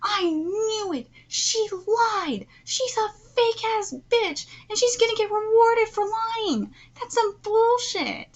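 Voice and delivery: high, indignant rant; worked up and incensed at a liar; angry outburst. Real, not acted. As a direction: rant in outrage, pitch climbing. Furious reaction to a character lying.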